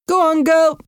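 high, lifted call to a horse; shout. A narrator's reading. Jimmy urging his old horse to move, shouted. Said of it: "Jimmy shouted to his old grey horse".

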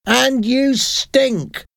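put-on old woman yelling after him, slow and high; shouted insult. A narrator's reading. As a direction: fling the final insult at his back. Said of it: "she shouted".

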